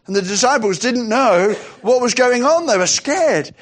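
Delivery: ordinary talk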